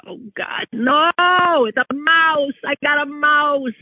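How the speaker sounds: 2 a panicky thought, high pitched; 3 rising alarm at the mouse; 1 mock panic. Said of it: "So I thought".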